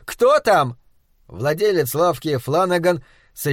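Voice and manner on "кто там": high shouted question; wife calls out asking who came; calling out. Acted. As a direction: call from the back room. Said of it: "громко крикнула его жена".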